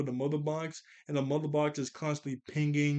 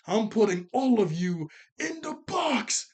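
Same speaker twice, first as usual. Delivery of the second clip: slow, higher, louder villain threat; menacing Granny; 6 semitones higher, 5 dB louder, slower than usual. Retold or imitated. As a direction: draw out the threat like a scheming granny. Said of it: "She goes".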